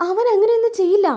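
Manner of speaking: high-pitched protest; acted pleading insistence; insistent denial. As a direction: defend him firmly, pitched high. Acted defence of Sreekanth.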